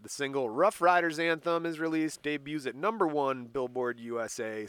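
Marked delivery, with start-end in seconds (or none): none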